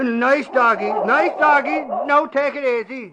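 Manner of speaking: high, loud, jittery coaxing of a vicious dog; nervous coaxing. Acted. Reporter faced with snarling watchdogs, nervous coaxing. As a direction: placate the dog while scared stiff. Soothing dogs that are attacking.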